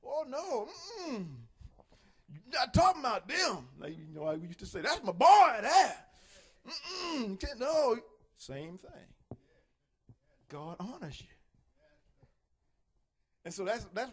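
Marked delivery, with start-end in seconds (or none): 0.0-8.0 higher, doting protective parent; proud parent. Retold or imitated. Proud parent refusing to hear talk against his boy. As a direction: defend your child with pride. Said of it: "just like a proud parent".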